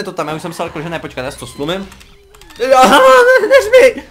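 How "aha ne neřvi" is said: yelling much higher and louder at the mob, half laughing; real shout. Real, not acted. Gamer yells at a zombie mob, loud and high. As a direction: scream it at the monster that startled you. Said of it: "to první neřvi bylo na něj".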